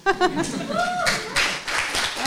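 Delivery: laughing at herself; high burst of laughter. Real, not acted. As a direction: break out laughing. Laughing at own slip.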